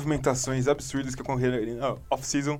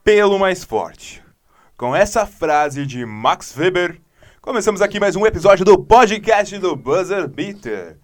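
announcer voice; 7 semitones higher, 10 dB louder than usual. Acted. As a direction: open the show like a radio host. Podcast opening announcement.

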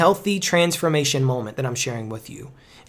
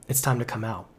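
quiet echo; 5 semitones lower, 8 dB quieter than usual. Real, not acted. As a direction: repeat "It's time to come out" much softer and lower. Repeated invitation, hushed echo.

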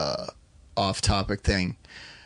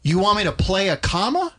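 higher-pitched, exasperated disbelief; incredulous; 8 semitones higher than usual. Retold or imitated. Actor to script supervisor over a punctuation note, incredulous. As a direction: retell it as a baffled, indignant question.

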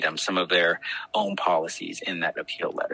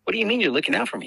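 much higher, fast, pressing questions; exasperated; 12 semitones higher, faster than usual. Retold or imitated. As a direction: demand what he really means. Retelling a tense call with boss.

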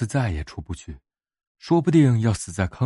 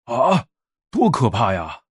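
a louder, alarmed gasp of a tiger; terrified tiger; 3 dB louder, slower than usual. A narrator's reading. 老虎听到天要塌，惊恐大叫. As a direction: gasp at the news in horror. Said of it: "老虎惊恐万状地回答道".

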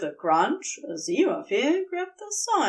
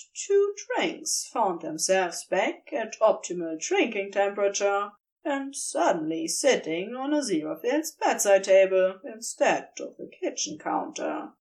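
plain reading voice

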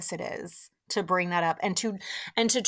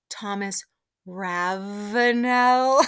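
drawn-out reveal; 5 dB louder, much slower than usual. Retold or imitated. Imitating Andy revealing the name.

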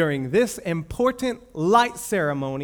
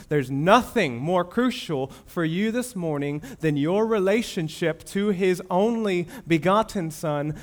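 ordinary talk

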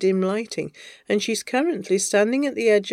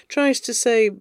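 ordinary delivery

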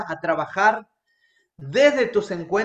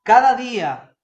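cada día punched loud and higher; emphatic; 3 semitones higher, 6 dB louder than usual. Real, not acted. Preacher stressing to congregation, cada día emphasized. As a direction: hammer the words cada día. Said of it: "Fíjate".

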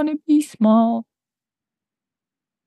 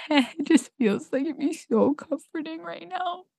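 laughing through tears; 5 semitones higher, 5 dB quieter than usual. Real, not acted. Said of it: "I'm crying".

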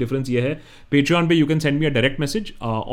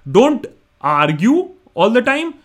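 higher, slower, insistent; emphatic urging; 10 semitones higher, 5 dB louder, slower than usual. Real, not acted. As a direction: press the point home hard. Urging viewers, raised voice.

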